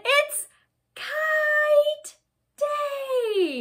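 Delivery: high, loud, drawn-out cheer; joyful shout. A narrator's reading. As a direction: shout it out high, stretching each word. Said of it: "he smiled and he shouted".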